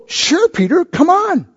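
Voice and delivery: high and soft, a gentle invitation; inviting Jesus. Retold or imitated. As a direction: coax him kindly onto the water. Jesus invites Peter onto water.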